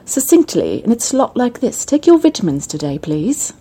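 succinct Queen's English, mock British; Queen's English accent. Shown as a demonstration. Host contrasting it with Cockney, Queen's English accent. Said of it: "you've got the Queen's English".

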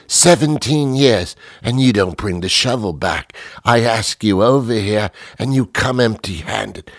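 mock British huff, each grievance punched out; hammed-up British indignation; indignant British accent. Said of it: "we just jump right into the accent".

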